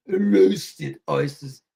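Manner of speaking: low, slow put-on British accent; drawn-out British mimicry; mock British. Imitating Clever on roasted oysters, mock British. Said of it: "She sounded so British".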